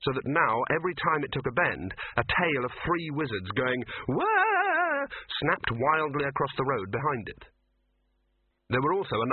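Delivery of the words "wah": high loud yell; wild wah yell. A narrator's reading. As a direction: yelp as you're whipped round. Wizards yelling while dragged.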